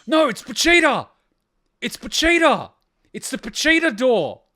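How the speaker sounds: loud, high, thrilled shouts of the name; he's overjoyed to spot Pochita; excited yelling. Real, not acted. Fan shouting at the screen, loud excited recognition.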